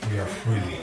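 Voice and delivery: usual speaking voice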